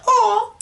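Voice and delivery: high-pitched cooing "aw"; adoring "aw". Retold or imitated. Fan recalling the toy, high melting coo. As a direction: coo at something cute. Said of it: "I thought that was so cute".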